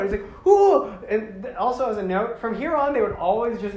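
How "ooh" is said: high, loud comic whoop; whooping call. Retold or imitated. Toa Liwa answers his fans, loud high whoop. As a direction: whoop as a swooping hero.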